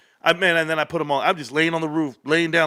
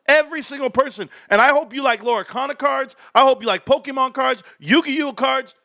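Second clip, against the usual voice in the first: hyped up; 8 semitones higher than usual. Real, not acted. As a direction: announce it like a hype man. Hyped gift declaration.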